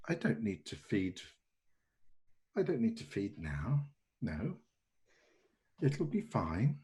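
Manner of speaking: softly trailing to near silence; muttering to himself. Acted. Hungry host muttering to himself, fading quietly. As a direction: talk himself down from hunger, fading. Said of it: "he's not really talking to you now".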